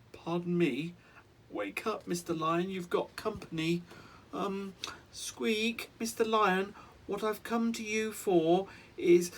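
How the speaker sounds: high, squeaky, timid mouse voice; nervous mouse. Acted. The tiny mouse waking the lion, asking timidly. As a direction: ask politely with a quaking squeak.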